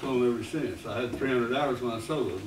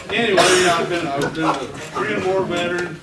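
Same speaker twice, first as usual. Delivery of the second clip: ordinary delivery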